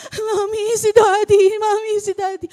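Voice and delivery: high, loud crying plea to mommy; sobbing shout of a scared daughter; crying child. Retold or imitated. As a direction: cry out for mommy in panic. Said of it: "ang ate A A ito umiiyak".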